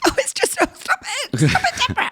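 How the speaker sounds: very high, squeaky laughing impression; gasping mock-weepy protest; mother crying with laughter. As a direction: imitate mum helpless with tearful giggles. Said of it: "she starts crying".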